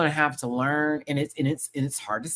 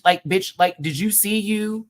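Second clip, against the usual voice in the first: ordinary talk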